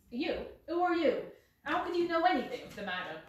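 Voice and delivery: suspicious, jumpy little man; alarmed. Acted. Startled man challenging Holmes.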